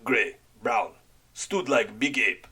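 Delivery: put-on slow, broken English in a heavy foreign accent; halting foreign accent, searching for words; frightened man in a heavy accent. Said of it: "in his heavy accent".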